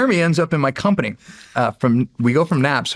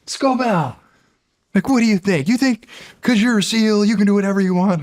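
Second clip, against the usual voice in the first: imitated yelling; 8 semitones higher than usual. Upperclassman yelling at the late plebe, mock angry. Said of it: "start screaming and yelling at me".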